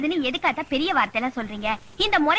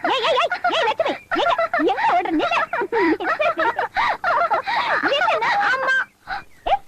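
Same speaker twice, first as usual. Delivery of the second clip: calling out; 9 semitones higher than usual. Acted. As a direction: shout her name to stop her. Calling after a girl running off. (ஓடுபவளைத் தடுக்க உரத்த அழைப்பு)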